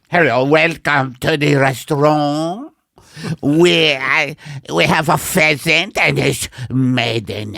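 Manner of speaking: loud, high and grand, like a showy waiter; fancy waiter voice. Pretend. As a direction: welcome the diners with booming pomp. Playing a restaurant waiter.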